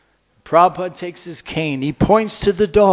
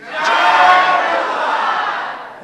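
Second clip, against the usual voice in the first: higher, drawn-out slow jubilant shout; bigger cheer; 11 semitones higher, much slower than usual. Acted. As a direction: stretch the cheer out, call it even higher. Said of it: "Let's try it again".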